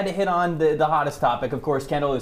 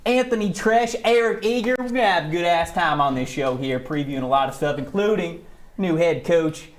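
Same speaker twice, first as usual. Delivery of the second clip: Brian Kelly's fake Southern accent; 2 semitones higher than usual. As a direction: put on a bad Southern accent, stretching key words. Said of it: "I just had to get my Brian Kelly impression".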